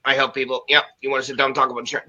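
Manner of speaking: low, soft, dead-tired sales patter; mock lifeless advisor pitch, rushed at the end; lifeless old advisor. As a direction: drone it out like a bored old insurance man. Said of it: "They were, like, dead".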